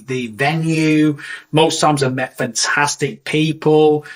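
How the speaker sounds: ordinary talk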